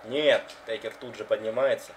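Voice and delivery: live wrestling play-by-play; wrestling commentator. Acted. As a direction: call the match live. (комментатор рестлинга)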